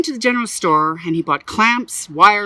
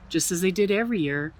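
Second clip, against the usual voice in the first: ordinary delivery, nothing marked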